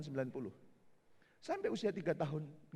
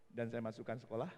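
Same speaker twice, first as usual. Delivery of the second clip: ordinary talk, nothing marked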